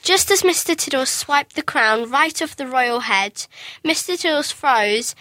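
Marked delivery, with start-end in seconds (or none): none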